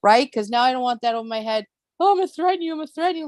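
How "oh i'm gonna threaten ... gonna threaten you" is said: mocking threat.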